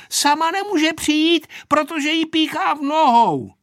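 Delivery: put-on high, loud child's voice; girl shouting. A narrator's reading. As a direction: call the news out like a little girl. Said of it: "křičela Aneška". (dětsky křičet vysokým hlasem)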